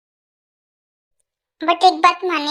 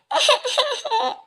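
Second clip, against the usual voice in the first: choked sob; 5 semitones higher, 3 dB quieter than usual. Acted. Crying breakdown over Tej.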